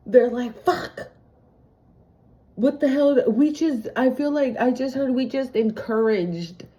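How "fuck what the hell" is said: mock shock. Voicing upset rivals.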